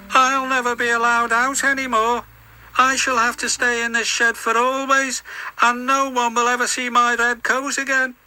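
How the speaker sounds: high, put-on engine voice, sorry for himself; a high little engine voice full of self-pity; James the engine, glum. James alone in the shed, high glum engine voice.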